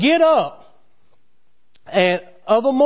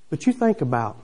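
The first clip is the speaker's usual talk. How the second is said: ordinary talk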